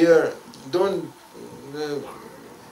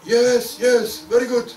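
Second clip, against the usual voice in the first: higher, louder, gleeful; eager ego-pleased yes; 8 semitones higher, 4 dB louder, slower than usual. Acted. Beginner enjoying the insults for his ego, louder and eager. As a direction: lap up the praise greedily. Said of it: "And you say".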